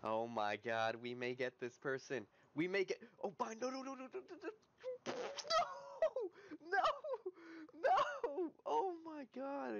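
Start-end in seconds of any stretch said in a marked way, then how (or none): 2.6-9.8 high, frantic run into wailing no's; panic, pitch climbing to a scream; panicked cries. Real, not acted. Gamer watching a kill slip away, panicked cries.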